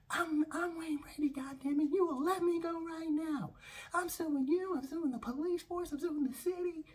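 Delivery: very high squeaky mock tantrum; steaming impostor. Impostor threatening to sue the police, squeaky furious tantrum. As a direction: throw a high-pitched indignant fit. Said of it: "Wayne Brady's steaming this time".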